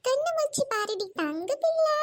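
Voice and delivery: ordinary talk, nothing marked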